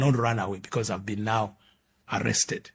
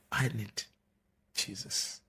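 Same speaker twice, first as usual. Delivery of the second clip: hushed plea; 2 semitones lower, 12 dB quieter, slower than usual. Retold or imitated. Desperate confession to her.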